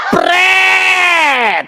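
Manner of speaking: sharp high scoffing "Pret!" thrown at the name; mocking pret. Preacher scoffs at the borrowed church name, mocking. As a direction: scoff it out like a raspberry.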